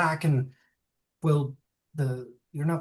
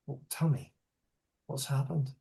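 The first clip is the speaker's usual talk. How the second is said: grave quiet; 6 dB quieter than usual. Acted. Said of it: "realizing the gravity of what".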